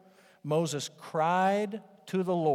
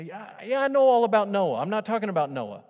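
louder, higher, brushing the question off; higher, louder doubter, a bit fed up; impatient doubter; 5 semitones higher, 8 dB louder than usual. Retold or imitated. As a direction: wave it away, it's old news. Preacher voicing an impatient doubter.

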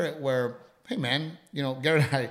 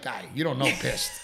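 usual speaking voice

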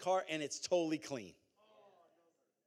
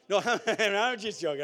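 loud, higher, through a laugh; 4 semitones higher, 9 dB louder than usual. Real, not acted. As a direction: laugh off your own joke, loudly. Joking with the congregation, laughs.

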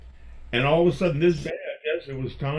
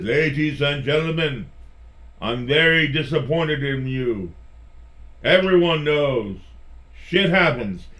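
put-on German accent; 4 semitones higher, 6 dB louder than usual. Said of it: "in his thick German accent".

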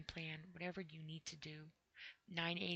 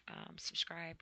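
ordinary talk

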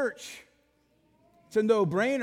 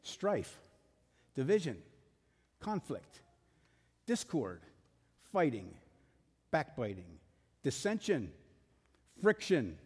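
low, measured, one word at a time; deliberate list reading; 6 semitones lower, 4 dB quieter than usual. Acted. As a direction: let each word fall and hang before the next. Reads a list of discord words.

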